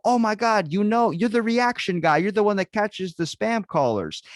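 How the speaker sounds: high, loud, acted astonishment; amazed recognition. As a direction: imitate scammers gasping out who they've recognized. Scammers recognize the video maker.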